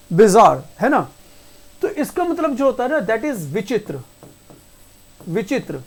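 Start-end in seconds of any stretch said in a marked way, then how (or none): 0.1-0.6 bizarre as two syllables, bi-ZAAR, no "ay" ending; correct pronunciation. Shown as a demonstration. Teacher correcting students' misreading, bizarre said as bi-ZAAR. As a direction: end bizarre on a long "zaar", falling. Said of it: "it is bizarre".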